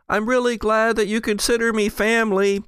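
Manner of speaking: high, fast call through the walls; yelling from another room. Acted. Skeen shouting to the party from a distant room, high and fast. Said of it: "Skeen yells".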